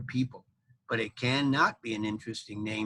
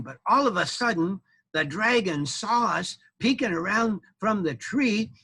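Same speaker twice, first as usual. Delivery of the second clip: usual speaking voice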